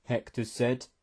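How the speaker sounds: ordinary delivery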